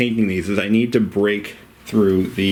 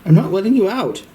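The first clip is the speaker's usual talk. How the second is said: high pet voice. Real, not acted. Owner teasing cat Oreo in a high pet voice.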